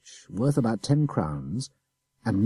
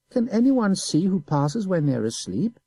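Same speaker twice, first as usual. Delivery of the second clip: put-on high, innocent boy's voice; simple child; 11 semitones higher, 4 dB louder than usual. A narrator's reading. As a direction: play the guileless little boy. Boy innocent, slow.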